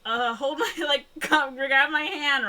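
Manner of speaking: high, loud retold rescue cry; hammed-up desperate call; mock urgency.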